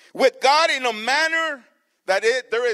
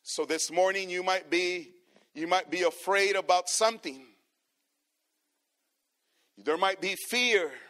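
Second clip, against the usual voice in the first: ordinary delivery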